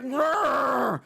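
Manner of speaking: put-on an old coach's angry snarl; old coach growl. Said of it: "And he's like".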